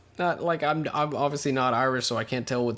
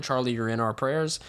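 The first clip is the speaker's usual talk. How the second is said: ordinary talk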